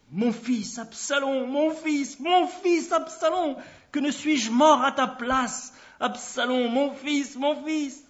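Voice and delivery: plainte lente, haute et forte d'un père en deuil; lamentation déchirante. A narrator's reading. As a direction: crier sa douleur en appelant son fils mort. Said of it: "et pleura". (lamentation du père éploré)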